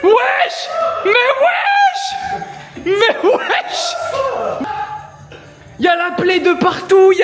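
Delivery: high, loud, disbelieving yells; shocked shout. Real, not acted. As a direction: scream in astonishment. Shouting at the slide.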